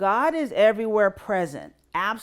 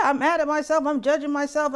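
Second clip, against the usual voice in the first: higher, louder, frustrated self-reproach; self-critical inner voice; 8 semitones higher, 5 dB louder than usual. Acted. Voicing someone angry at themselves, raised and higher. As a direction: scold yourself in a raised voice.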